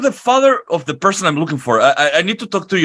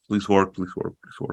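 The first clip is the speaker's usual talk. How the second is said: pleading mutter; 7 semitones lower, 8 dB quieter than usual. Acted. Wizard pleading spell works.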